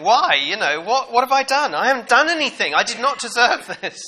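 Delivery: high, loud anguished protest; crying out to God. Retold or imitated. Job in his misery cries out to God, high and loud. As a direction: rail at heaven over unfair suffering. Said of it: "he's crying out to God".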